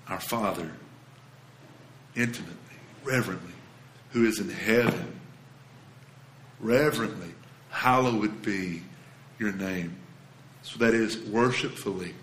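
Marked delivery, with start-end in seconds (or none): 0.1-9.9 reverent prayer. Acted. Pastor quietly modelling the Lord's Prayer for the congregation, reverent.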